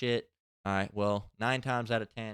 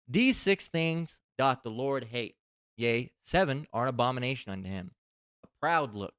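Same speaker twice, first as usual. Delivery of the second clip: emphatic, measured Bible reading; scripture reading; 5 dB louder than usual. Acted. Reading Proverbs to his listeners before closing, emphatic scripture reading. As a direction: proclaim each phrase with weight.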